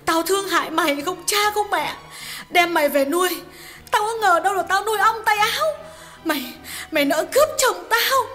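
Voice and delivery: high-pitched female voice, loud and raging; wife's furious high-voiced rant; furious tirade. A narrator's reading. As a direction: scream abuse at the husband's lover in a wife's high voice. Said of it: "những lời thịnh nộ chưa từng thấy".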